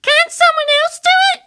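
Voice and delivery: high, reluctant protest; maid of honour. A narrator's reading. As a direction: whine it in a high girlish voice. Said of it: "she said".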